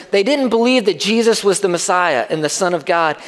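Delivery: ordinary delivery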